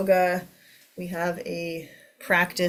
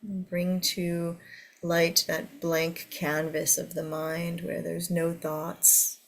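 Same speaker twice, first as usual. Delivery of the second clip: guided meditation. Acted. Leads a meditation.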